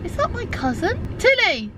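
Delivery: spot a cousin and call out to her; surprised shout of a name. Real, not acted. Spots cousin, calls to her.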